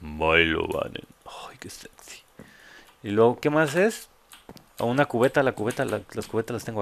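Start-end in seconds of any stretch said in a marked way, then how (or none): 0.0-1.1 deep, slow macho imitation. Streamer copying the alert's macho voice, deep and slow. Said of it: "una pinche voz de macho". 1.2-2.2 very soft, playful whisper; mock-sexy whisper. Streamer reacting to the deep alert voice, mock-sexy whisper.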